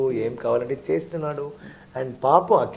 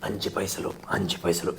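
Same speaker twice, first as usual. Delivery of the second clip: mild contrast voice; 6 semitones lower, 6 dB quieter than usual. Retold or imitated. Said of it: "It's not like Onion is like".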